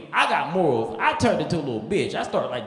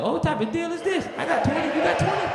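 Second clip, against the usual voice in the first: high, incredulous mock outrage; mock surprise; 11 semitones higher than usual. Tourist reacting to the bribe, high incredulous voice. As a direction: go way up in disbelief.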